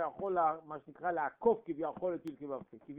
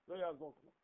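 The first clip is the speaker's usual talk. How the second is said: "לא יעזור כלום" dropped much softer; quiet close; 6 dB quieter, faster than usual. Shown as a demonstration. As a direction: let "לא יעזור כלום" fall away softly. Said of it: "לא יעזור שום דבר".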